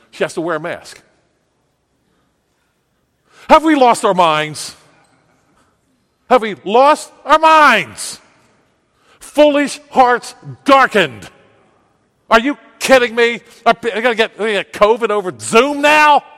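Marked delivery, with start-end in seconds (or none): 3.5-8.2 high, loud shout of disbelief; outraged shout. Real, not acted. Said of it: "I didn't wanna shout". 9.2-11.3 slow, high, emphatic shout. Real, not acted. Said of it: "I didn't wanna shout". 12.3-16.2 loud, high-pitched ranting; incredulous shout. Real, not acted. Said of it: "I didn't wanna shout".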